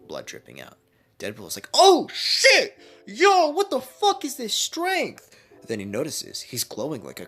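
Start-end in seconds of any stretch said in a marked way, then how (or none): 1.7-5.3 very high, loud amazed yell; shocked shout. Acted. Deadpool shocked at his own strength, high loud yell. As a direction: blown away by your own power.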